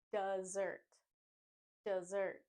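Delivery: dessert as "duh-ZURT", z sound; weak duh, then strong ZURT in dessert; stress on second syllable. Shown as a demonstration. Teacher modelling dessert for learners, stressed ZURT. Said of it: "we say".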